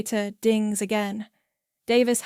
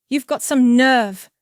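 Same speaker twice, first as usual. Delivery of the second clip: loud, angry snarl; 3 semitones higher, 9 dB louder than usual. A narrator's reading. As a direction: snarl it out forcefully. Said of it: "Brock snarls".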